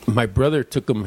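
ordinary talk, nothing marked

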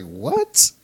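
high-pitched retold double take; incredulous. Driver hearing the Slipknot request, exaggerated disbelief. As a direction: jump up in pitch, mock astonished.

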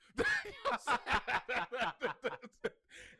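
long, loud burst of laughter; hearty laugh. Real, not acted. Laughs at own pistols joke.

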